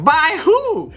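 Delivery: sharp high surprised question. Retold or imitated. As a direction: ask it in sudden disbelief. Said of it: "I'm like".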